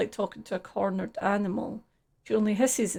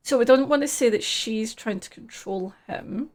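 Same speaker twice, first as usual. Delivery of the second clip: ordinary talk, nothing marked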